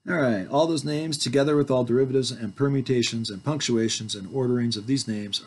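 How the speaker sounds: ordinary delivery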